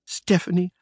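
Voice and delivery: slow, high dreamy sighing of her name; lovesick murmur. Acted. Lovestruck boss murmurs the countess's name, slow and dreamy. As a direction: sigh her name like a lover. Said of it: "I murmured".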